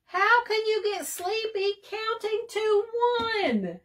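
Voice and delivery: very high, crying sing-song; frustrated wail. A narrator's reading. Bird wailing at Sheep, near tears. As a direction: wail like a tantrum.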